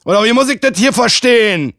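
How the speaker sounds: hoch und laut, gereizt zur Rede stellen; wütender Mann. Acted. (wütend und laut fordernd)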